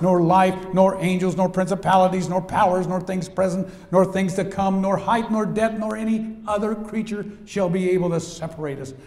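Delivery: loud, high, ringing pulpit reading; preaching scripture. Acted. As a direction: proclaim every "nor" to the hall.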